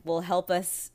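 normal talking voice

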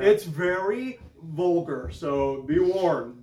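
slow, deliberate words of caution; a slow, measured heads-up to the listeners; slow warning. Real, not acted. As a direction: spell out the warning slowly so it lands.

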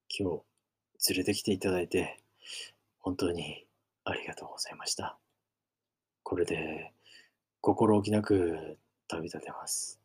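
soft, faint dying thanks with whispered patches; weak grateful whisper. Acted. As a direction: say goodbye with your last breath. Dying friend thanks the narrator.

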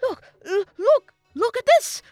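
high, loud burst of excitement; loud, high-pitched eager outburst; excited professor. A narrator's reading. Professor shows off a startling discovery, very excited. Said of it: "jumping up and down".

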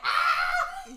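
high loud yelp; excited yelp. Retold or imitated. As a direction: let out a giddy shriek. Yelp leaving store.

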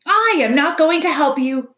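high, loud owl screech. A narrator's reading. Owl refuses the robot's request, screeching. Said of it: "screeched the owl from the very top".

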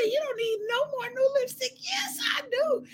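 high, soft mock objection; mock protest. Acting out a texted spat over lipstick, high mock protest. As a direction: squeal it softly in mock dispute. Retells friend's objection, her comeback.

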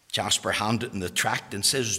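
ordinary talk, nothing marked

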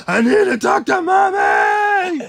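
put-on child shouting. Child shouting to dad that he needs mommy, high and drawn out. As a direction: wail it like a desperate toddler. Kid voiced.

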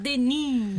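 Denis as "de-NÍ", final S silent, last vowel drawn out; French-style DENÍ. Shown as a demonstration. Host showing what Canadian fans call him, Denis as "de-NÍ". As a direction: say Denis the French way, stress on the end. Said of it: "francés".

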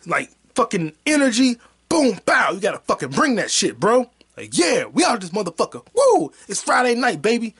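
high, loud hyped-up party voice; loud mock excitement; hype energy. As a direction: pump it up like a hype man. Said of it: "You had to talk like that".

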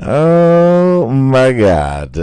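loud laughing oh my God; shocked laughter. Real, not acted. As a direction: burst out in disbelief. Reaction to Antonio Brown walkout.

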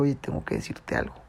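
hushed, telling a secret; whisper. Retold or imitated. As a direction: whisper to the neighbor that he has something to say. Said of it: "Y le dije".

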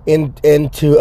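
normal talking voice

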